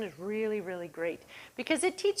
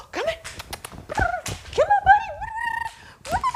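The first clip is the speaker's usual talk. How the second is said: squealing, much higher and louder calls to a dog; excited calling; 9 dB louder than usual. Shown as a demonstration. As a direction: get the dog wound up with a high happy call. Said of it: "get him ginned up and excited".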